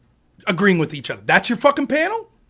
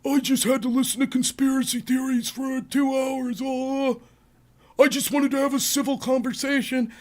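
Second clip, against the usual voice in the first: lightly whiny put-on voice; quoting her post on X, a touch whiny; mock victim voice; 2 semitones higher, 5 dB quieter than usual. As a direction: mimic her wounded post, mocking. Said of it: "she takes to X".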